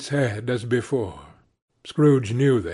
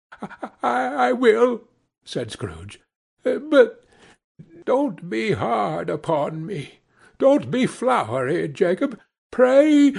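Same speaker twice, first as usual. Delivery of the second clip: put-on higher, stammering old man begging; a hint of a scared old miser; frightened Scrooge pleading; 9 semitones higher than usual. A narrator's reading. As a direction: beg the ghost nervously, rising to a cry on pray. Frightened Scrooge begs the ghost.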